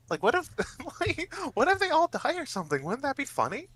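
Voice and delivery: high, quick, laughing excitement; giddy scheming. Acted. Player's character pitches chaos to the huddle, giddy and high. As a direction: pitch a wicked idea in a high, giggly rush.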